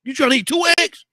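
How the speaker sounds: high squeaky mock outrage, loud; high-pitched, playfully scandalized. Teasing mock outrage at eggs.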